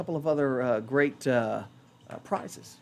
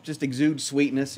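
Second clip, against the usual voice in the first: normal talking voice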